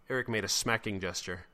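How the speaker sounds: ordinary delivery, nothing marked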